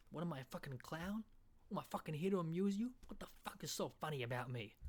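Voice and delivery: hushed, husky American mobster; Goodfellas gangster impression. Comedian quoting Goodfellas, hushed edgy gangster. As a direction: challenge them like a touchy film gangster. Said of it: "Then you got Goodfellas".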